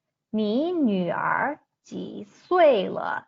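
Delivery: slow, clear Mandarin read for learners; careful Chinese example. Shown as a demonstration. Teacher reading a Mandarin example slowly to learners. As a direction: sound out each tone of "你女儿几岁了" slowly. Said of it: "For example".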